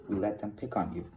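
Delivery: plain reading voice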